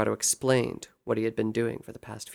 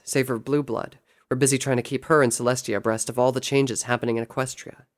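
usual speaking voice

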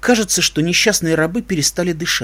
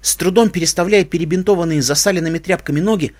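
ordinary delivery

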